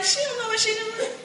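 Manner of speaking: high-pitched mocking voice of a doubting client; doubtful prospect. Imitating a doubtful buyer, high and laughing. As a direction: sneer it like a skeptic who doubts her. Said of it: "You're gonna be like".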